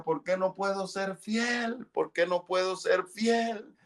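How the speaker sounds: high, pleading cry to God; retold desperate prayer; desperate plea. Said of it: "gritaba desesperado".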